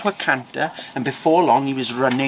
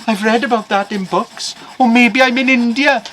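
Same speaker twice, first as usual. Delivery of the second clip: high voice climbing louder with each guess; bewildered excitement; 10 semitones higher, 7 dB louder than usual. Acted. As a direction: guess wildly, getting more worked up. Farmer guesses wildly where he is.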